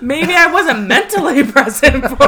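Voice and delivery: laughing through the words. Real, not acted. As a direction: giggle it out, caught out. Teased about drinking, laughing.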